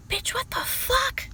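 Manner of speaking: stunned thought said under breath; shocked inner voice. Retold or imitated.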